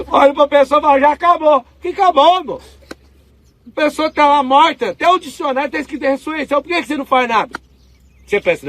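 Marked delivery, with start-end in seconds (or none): 0.1-7.6 higher and louder, preaching with fire; fired-up preaching. Real, not acted. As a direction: push the congregation, indignant. Pregação indignada. (pregação inflamada, mais alta e mais forte)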